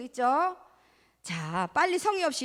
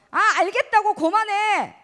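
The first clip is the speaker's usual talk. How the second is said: loud, higher irritated snap; annoyed child; 7 semitones higher, 9 dB louder than usual. Acted. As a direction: brush off a nagging parent. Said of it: "이렇게 하는 친구". (짜증 내며 대꾸하는 아이)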